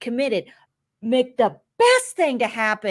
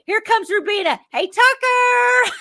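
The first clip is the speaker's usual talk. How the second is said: mock Rubina greeting; 13 semitones higher, 6 dB louder than usual. Impression of a housemate gushing at Tucker. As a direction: squeal it up high like a fan spotting him. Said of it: "This is all, like".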